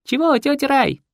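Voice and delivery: put-on high girl's call from a window; a young girl calling back; teenage girl. The girl calls back to aunt Raya from the window, high.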